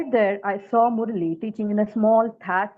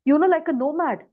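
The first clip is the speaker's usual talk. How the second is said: indignant self-defence, higher-pitched and insistent; very angry; 5 semitones higher than usual. Acted. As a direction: throw the sacrifice back at an ungrateful brother. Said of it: "I was very angry".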